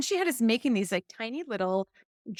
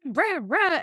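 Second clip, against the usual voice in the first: put-on high, loud childish tantrum grumble; mock tantrum; 8 semitones higher, 6 dB louder than usual. Retelling her inner child's tantrum over the horse tail, loud mock growl. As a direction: rage like a toddler throwing a fit, half in jest. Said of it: "and I was like".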